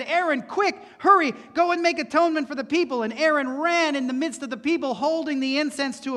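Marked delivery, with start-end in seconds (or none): 0.0-3.0 higher, louder, fast urgent call; urgent command. Acted. Moses urging Aaron to stop the plague, urgent and fast. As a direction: hurry him off as if lives hang on it.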